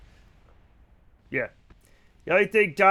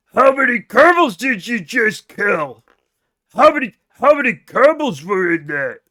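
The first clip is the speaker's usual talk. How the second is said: mock orc chat; 4 dB louder than usual. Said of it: "you guys sound like fucking World of Warcraft orcs".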